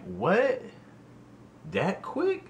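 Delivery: high, stunned what then that quick; shocked disbelief. Retold or imitated. Hearing about her new boyfriend, stunned disbelief. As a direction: caught off guard by how fast she moved on. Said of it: "I'm like".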